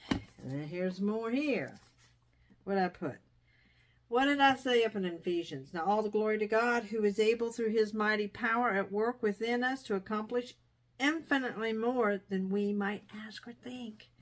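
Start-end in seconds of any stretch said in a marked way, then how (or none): 5.7-14.1 higher, louder earnest reading of a verse; scripture reading. Acted. To viewers, reading Ephesians aloud as a fervent proclamation. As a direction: read it out as praise.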